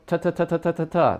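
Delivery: quick repeated ta; ta ta ta drill for trumpet tonguing; tonguing syllables. Shown as a demonstration. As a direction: tap ta with the tongue tip.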